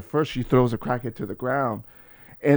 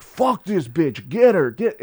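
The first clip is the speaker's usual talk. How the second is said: raised, drawn-out mock mob yell; rally the crowd to attack; angry mob; 5 semitones higher, 6 dB louder, slower than usual. Everybody going after the culprit, imitated angry yell.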